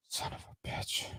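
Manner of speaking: very soft, whispered swearing; whispered curse. Real, not acted. Streamer swearing at a dead stream, whispered. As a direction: curse under your breath in a whisper. Muttered curse at failing tech.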